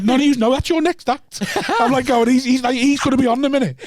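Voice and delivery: high-pitched retold pleading, half laughing; amused protest. Protests while wrestling next act.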